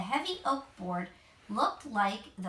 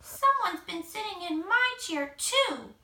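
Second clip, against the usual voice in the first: higher, a touch tearful cub; crying Baby Bear; 8 semitones higher than usual. A narrator's reading. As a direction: cry over the broken chair. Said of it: "cried Baby Bear".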